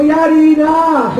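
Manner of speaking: high, slow shocked cry; mock panic. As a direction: cry out as if trapped. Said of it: "Sabi ko".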